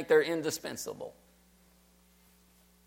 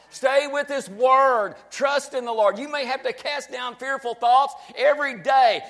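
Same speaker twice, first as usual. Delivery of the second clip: loud, high preaching at full force; rising exhortation; 7 semitones higher, 3 dB louder than usual. Real, not acted. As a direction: lift the voice and urge them to stay with God.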